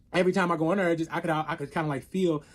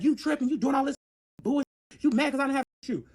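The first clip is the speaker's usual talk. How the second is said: higher, heated accusing quote; imitated angry boyfriend; 8 semitones higher than usual. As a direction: go off at her, pitch up. Boyfriend goes off in public.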